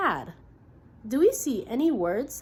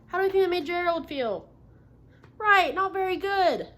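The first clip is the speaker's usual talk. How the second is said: usual speaking voice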